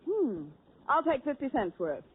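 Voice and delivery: ordinary talk, nothing marked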